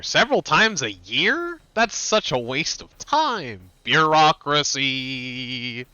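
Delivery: high, slow, incredulous protest; hammed-up indignation with long-drawn words; mock outrage. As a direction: drag it out in exaggerated disbelief. Mock outrage at game dialogue.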